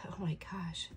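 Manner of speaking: breathless, overwhelmed whisper; awed whisper. Real, not acted. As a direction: whisper it as if struck by the card. Overwhelmed during tarot reading.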